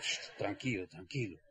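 soft hushed shh, calming; low soft soothing murmur to himself; hushing himself. Retold or imitated. Calming himself down at the seminar, soft and hushed.

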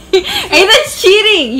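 high, laughing call of cheating; laughing accusation. Real, not acted. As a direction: accuse while cracking up.

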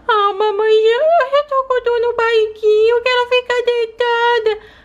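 put-on slow, high, whimpering child voice; a sick little daughter complaining to mommy; whiny sick little girl. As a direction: whine to mom about the pain. Sick doll tummy ache.

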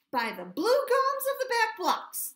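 high, loud indignant snort; a proud troop horse snorting in disbelief; snorting exclamation. Acted. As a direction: blurt it out like an offended old cavalry horse. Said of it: "snorted the truth, troop horse".

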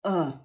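E as a low, dull "uh"; frog vowel. Shown as a demonstration. Teacher shows the sacs under the jaw, low frog "uh" for E. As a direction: puff out E as a frog-like "uh". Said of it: "ten dźwięk żabim E".

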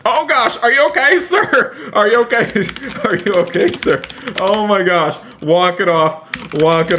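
high-pitched panic breaking into laughter; laughing mock alarm. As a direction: fuss over the victim while cracking up. Just ran over friend in game.

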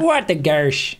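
put-on folksy Minnesota accent; mock-Midwestern exclamation. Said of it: "my favorite American accent is a Midwest accent".

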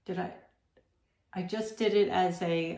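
normal talking voice

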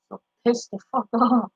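normal talking voice

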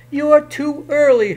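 high, loud panicked shriek. Acted. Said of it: "Herschel shrieked".

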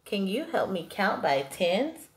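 ordinary delivery

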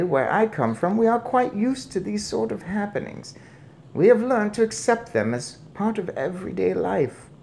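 put-on high-pitched, unruffled old lady; calm grandmother. A narrator's reading. Grandmother explains how Norway takes such happenings, calm. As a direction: reason with him evenly.

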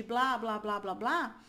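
usual speaking voice

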